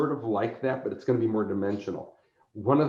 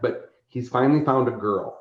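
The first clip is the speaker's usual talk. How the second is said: ordinary talk, nothing marked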